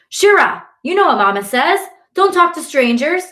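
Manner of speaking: high, loud scolding call; older girl yelling. A narrator's reading. Older girl scolding her sister for talking to strangers, yelling. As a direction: yell a warning to a little sister. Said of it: "an older girl yells".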